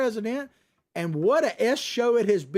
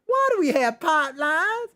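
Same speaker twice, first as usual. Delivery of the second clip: mock childish question; 8 semitones higher, 3 dB louder than usual. Mocking Buttigieg's obvious remark.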